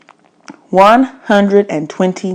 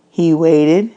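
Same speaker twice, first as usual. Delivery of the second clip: usual speaking voice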